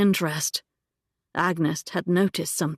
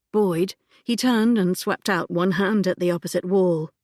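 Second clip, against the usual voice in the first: plain reading voice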